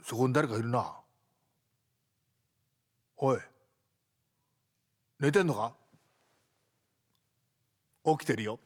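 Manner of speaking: ordinary talk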